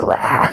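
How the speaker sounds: short mock monster growl; imitated growl. Marge scaring the mean girls, mock growl. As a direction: growl it out without voice, playfully. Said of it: "She, like, growls".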